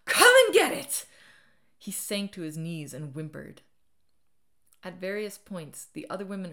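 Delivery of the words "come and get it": full scream. A narrator's reading. Enraged woman daring the man before her, screaming at full volume. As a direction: let rip with a screamed dare. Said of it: "I screamed".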